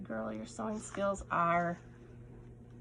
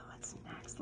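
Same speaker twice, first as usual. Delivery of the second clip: whisper; 14 dB quieter than usual. Real, not acted. Moving to next item, whispered.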